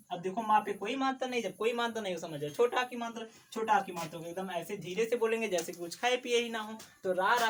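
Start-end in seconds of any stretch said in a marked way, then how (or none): none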